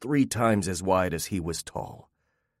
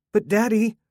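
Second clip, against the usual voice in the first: put-on small child's voice, much higher; little girl; 13 semitones higher, 4 dB louder than usual. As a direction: whine for her father's attention. Young daughter pleads.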